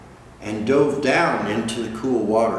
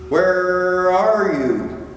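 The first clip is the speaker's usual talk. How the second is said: slow far-off call; 7 semitones higher, 4 dB louder, much slower than usual. Acted. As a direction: call slowly as if lost far away. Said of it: "cried Little Blue".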